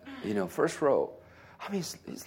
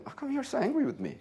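retold questioning; 14 semitones higher, faster than usual. Pastor retelling his friendly confrontation.

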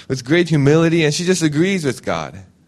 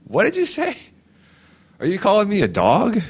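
high, indignant mock outrage; affronted squeal at the insult; offended retort; 9 semitones higher than usual. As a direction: bristle like you've been insulted. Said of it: "I'd be like".

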